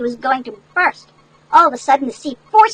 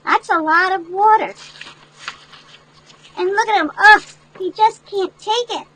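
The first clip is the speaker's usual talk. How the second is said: worried onlooker; 5 semitones higher, slower than usual. Acted. Reader voices worried onlooker.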